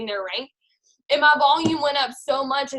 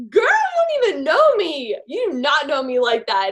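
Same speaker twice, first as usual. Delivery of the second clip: high, loud indignant comeback; defensive retort; 4 semitones higher, 6 dB louder than usual. Retold or imitated. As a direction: snap back, offended and defensive. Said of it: "a little, like, defensive at first".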